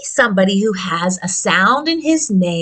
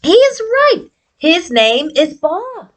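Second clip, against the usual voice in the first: higher puppet voice, then settling; 7 semitones higher than usual. Acted. Bob the puppet agrees, voiced higher. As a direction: play the puppet Bob answering.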